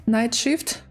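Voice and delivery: normal talking voice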